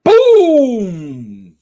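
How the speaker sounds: triumphant boom. Real, not acted. Triumphant exclamation.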